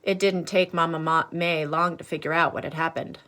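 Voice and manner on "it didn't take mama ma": ordinary delivery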